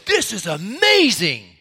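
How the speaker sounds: put-on high, awestruck child voice; amazed little kid. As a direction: say it like a delighted young boy. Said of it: "he said".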